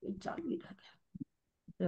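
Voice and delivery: real hushed whisper, slow; slow real whisper to herself. Real, not acted. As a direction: breathe it out under your breath. Aside realizing recording still running.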